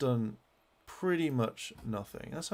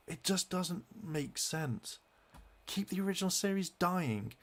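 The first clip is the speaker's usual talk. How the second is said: heated rant pitched higher, building exasperation; exasperated fan ranting at the producers, pitched higher; real angry rant; 5 semitones higher than usual. Real, not acted. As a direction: vent it, higher and fed up. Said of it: "I'm so fucking angry".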